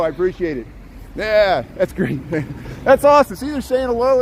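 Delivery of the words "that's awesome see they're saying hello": high, loud delight; excited. Real, not acted. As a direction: gush happily. Delighted by fans' greeting.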